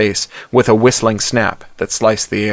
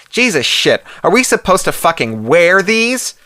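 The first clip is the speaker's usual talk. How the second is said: disgusted Yankee twang, pitched higher; 8 semitones higher than usual. Acted. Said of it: "a familiar Yankee twang".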